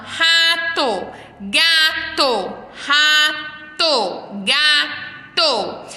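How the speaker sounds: RATO and GATO high, each split before a held TO; syllables sounded out. Shown as a demonstration. Teacher showing children a rhyme, syllables sounded out. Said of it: "o rato termina com to".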